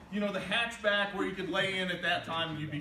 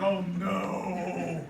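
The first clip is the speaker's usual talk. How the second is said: drawn-out, lower "oh no" of dread; mock dismay; 4 semitones lower, 4 dB louder than usual. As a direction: groan it out like a horrified parent, hammed up. Parents find empty big wheel.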